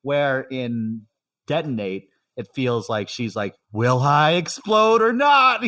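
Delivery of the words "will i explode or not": slow, raised, loud dramatic outcry; imitating anguished singer. Podcaster voices what the singer feels, anguished outcry. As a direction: cry it out high and drawn out.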